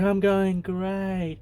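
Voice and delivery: put-on high, slow, swooning girl; slow, dazed, enchanted girl's voice; spellbound girl. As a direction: drag it out, high and lovestruck. Girl under love spell answers.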